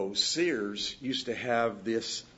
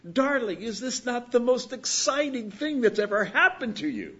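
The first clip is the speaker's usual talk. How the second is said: Zsa Zsa imitation; 10 semitones higher, 5 dB louder than usual. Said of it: "only in the way those sisters would do it".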